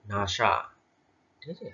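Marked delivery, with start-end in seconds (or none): 0.1-0.5 "নাসা" as one word, NA-SA; two-syllable word "নাসা", not spelled out. Shown as a demonstration. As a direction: join the letters into "নাসা". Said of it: "আমরা কি করছি?".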